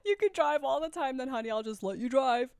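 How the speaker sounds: high, loud mocking imitation; mock obliging soulmate. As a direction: imitate an over-agreeable partner, hammed-up. Mocking imagined soulmate's offer.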